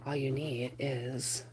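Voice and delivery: breathy intro whisper, unvoiced; spooky late-night whisper. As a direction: hush it like a secret welcome. Whispered intro before the live.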